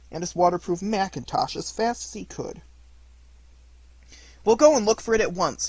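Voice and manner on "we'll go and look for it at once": high, louder call. A narrator's reading. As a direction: call out with brisk urgency. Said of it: "he called out to Eeyore".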